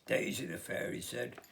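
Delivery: usual speaking voice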